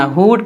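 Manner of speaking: "हुड" with the oo stretched long; wrong drawn-out oo. Shown as a demonstration. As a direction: drag out the vowel of "हुड". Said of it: "खींच के नहीं पढ़ना".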